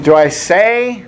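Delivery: normal talking voice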